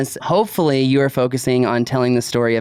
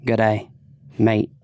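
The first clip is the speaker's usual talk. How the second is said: a light Australian "Good day, mate", lower; Australian accent; 4 semitones lower than usual. Shown as a demonstration. Signing off the show with an Australian goodbye. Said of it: "when you're Australian".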